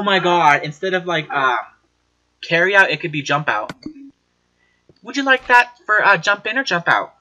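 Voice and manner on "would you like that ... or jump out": lightly voiced cashier, a bit higher and louder; playful cashier voice; mock cashier. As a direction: ask like a cheery drive-thru worker. Playing a cashier taking order.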